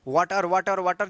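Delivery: वाटर repeated as "waa-tar"; wrong "aa" vowel. Shown as a demonstration. Teacher repeating the common mistake for learners, with aa. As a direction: open aa in each वाटर. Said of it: "गलत उच्चारण करते हैं".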